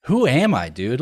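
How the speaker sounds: sudden loud, high dismay at himself; a jolt of self-disgust, loud and high; shocked self-question. Retold or imitated. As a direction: blurt it out in disbelief at yourself. Retold self-reproach.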